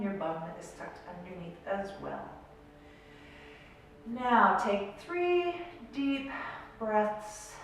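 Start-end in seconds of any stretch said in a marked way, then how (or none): 4.1-7.5 slow meditation voice with long pauses; guided meditation. Real, not acted. Coach guiding listeners to breathe and imagine, slow meditation voice. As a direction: lead them gently into stillness, leaving space between phrases.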